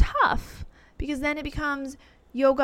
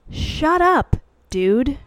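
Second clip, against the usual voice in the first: exasperated; 4 semitones higher, 5 dB louder, much slower than usual. Real, not acted. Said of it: "annoyed at the woo dude".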